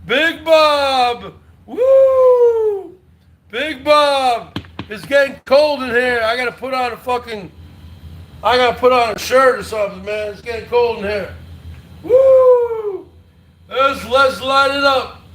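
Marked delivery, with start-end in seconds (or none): 1.7-3.0 high loud celebratory whoop. Real, not acted. Streamer cheering a donor, high loud whoop. 12.0-13.1 high loud celebratory whoop. Real, not acted. Streamer cheering a donor, high loud whoop.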